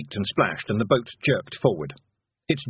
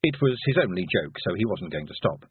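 plain reading voice

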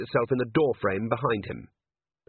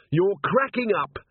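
shouting; 9 semitones higher, 4 dB louder, slower than usual. A narrator's reading. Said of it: "she shouted".

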